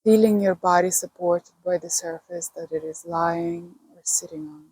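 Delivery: unhurried meditation guide with long pauses; calm, spacious breathing-and-body-awareness guidance; guided meditation. Acted. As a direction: lead listeners gently into relaxation, pausing between phrases.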